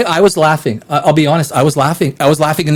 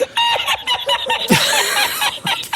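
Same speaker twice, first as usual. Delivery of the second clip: high squealing laugh; 8 dB quieter than usual. Real, not acted. Bursts out laughing mid-rant.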